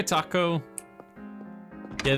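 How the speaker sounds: usual speaking voice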